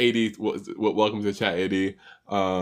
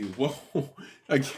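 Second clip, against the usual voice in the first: ordinary talk, nothing marked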